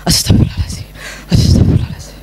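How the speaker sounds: breathy whispered prayer for patience; whispered istighfar. Acted. Mother whispers istighfar to herself before scolding her late son, whispered. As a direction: whisper it to yourself to keep calm. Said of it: "udah tarik nafas".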